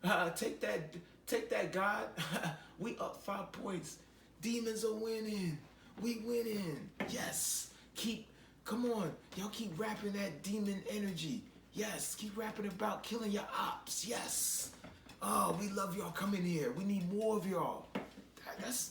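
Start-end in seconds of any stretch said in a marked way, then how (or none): 0.0-14.7 higher mocking demon voice; hammed-up demon taunt. 15.2-17.9 higher, louder sly welcome; mock-friendly demon invitation; welcoming demon. Demons welcoming new recruits.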